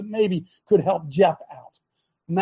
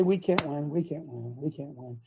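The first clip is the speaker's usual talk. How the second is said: a mocking moan that fades away, softer and lower each time; mock defeatist; 7 semitones lower, 10 dB quieter than usual. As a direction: mimic the defeatist churchgoers. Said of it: "we sit around and say".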